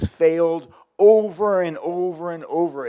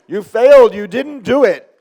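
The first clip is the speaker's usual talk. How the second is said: loud, high shout over the room; rousing shout; 4 semitones higher, 17 dB louder than usual. Real, not acted. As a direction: shout it out to fire up the whole class. Rousing class to applaud failure.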